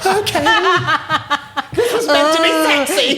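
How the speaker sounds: very high, whimpering squeak; hammed-up frightened squeak of compliance; mock intimidated. Intimidated girl giving in to threats, comic high squeal.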